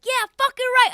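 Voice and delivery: loud, high shout of agreement; joining the heckle. Retold or imitated. Host backs up the six-year-old's heckle, loud high shout. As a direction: yell along like a rowdy fan.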